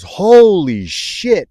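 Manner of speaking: high, loud, long-drawn awestruck yell; re-enacted yell. Storyteller re-enacts yelling in front of everybody, high and drawn out. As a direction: stretch both words out in a retold cry. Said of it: "I think I yelled it in front of everybody".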